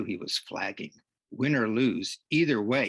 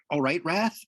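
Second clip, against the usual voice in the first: raised, higher-pitched question; concerned check; 7 semitones higher, 4 dB louder than usual. Acted. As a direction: check on an injured player. Coach checks on injured player.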